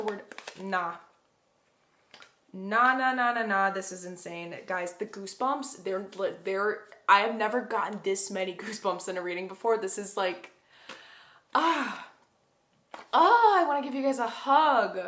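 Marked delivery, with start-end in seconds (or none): 2.5-3.7 disbelieving nah. Real, not acted. Reader reacting to a shocking reading, loud disbelieving nahs. 11.5-15.0 high, loud, overwhelmed with feeling; emotional gush. Real, not acted. Reader gushing to her viewers, high emotional cry.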